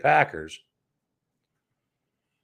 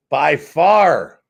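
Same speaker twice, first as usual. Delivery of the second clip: emphatic; 10 dB louder than usual. Real, not acted. Emphatic stat rant.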